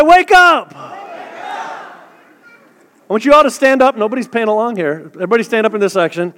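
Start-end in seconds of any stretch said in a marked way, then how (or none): 0.0-0.7 loud, high rousing shout; shouted wake up. Real, not acted. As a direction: rouse a sleepy crowd. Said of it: "I said".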